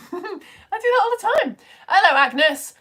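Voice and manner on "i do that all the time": high, loud put-on quote; imitating Tom. Host voicing viewer Tom's comment, high imitation. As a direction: pipe up high as the other person. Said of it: "Tom's going".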